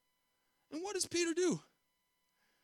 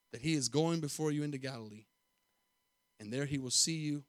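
ordinary talk

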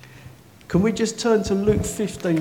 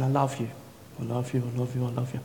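low, soft repeated murmur; quiet insistence; 5 semitones lower, 7 dB quieter, faster than usual. Retold or imitated. Holy Spirit keeps telling preacher of love, quietly insistent. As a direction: repeat it gently, low and unhurried. God's repeated words retold.

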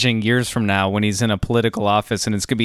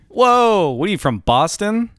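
mock disbelief; 7 semitones higher than usual. Real, not acted. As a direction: tease with exaggerated surprise. Teasing friend over ketchup rule.

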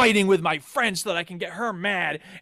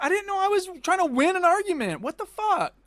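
high-pitched baffled protest; retold incredulity; 9 semitones higher than usual. Player learning the game was about winning an argument, high baffled protest. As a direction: whine it in exasperated disbelief.

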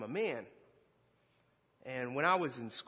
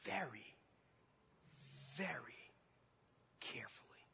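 fading to a whisper; 6 semitones higher, 5 dB quieter than usual. Acted. Gentle scalpel image, fading to whisper.

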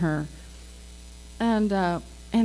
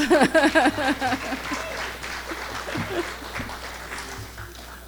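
a long rolling laugh, tapering off; long laughter; 5 semitones higher, 3 dB quieter than usual. Real, not acted. Laughing at her own Harley Davidson story, long and rolling. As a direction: laugh freely after the punchline.

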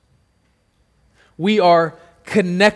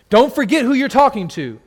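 usual speaking voice

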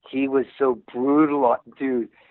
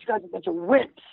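mock Australian taunt; 7 semitones higher, faster than usual. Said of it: "He'd call us".

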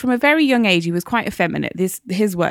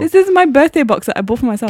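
high, loud mock indignation; playfully outraged protest; mock indignant; 6 semitones higher, 5 dB louder than usual. Chocolate being taken.

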